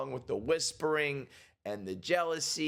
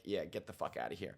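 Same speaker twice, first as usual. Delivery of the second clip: mock dismissive; 5 semitones lower, 8 dB quieter than usual. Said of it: "Victoria's like".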